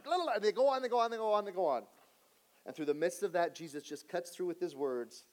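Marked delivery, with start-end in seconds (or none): 0.0-1.9 high, loud babble tailing downward; mocking singsong of endless griping; mock rambling. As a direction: rattle it off in a raised, repetitive stream. Mimics the guests' endless griping.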